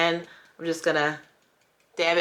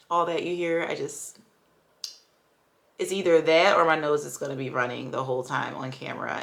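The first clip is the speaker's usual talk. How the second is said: ordinary talk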